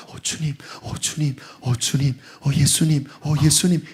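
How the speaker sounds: whispered, soft murmured calls; hushed inner prayer. Pretend. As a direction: murmur to the Lord under your breath. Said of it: "계속 속에서".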